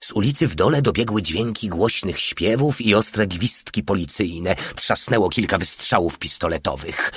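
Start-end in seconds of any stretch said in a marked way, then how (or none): none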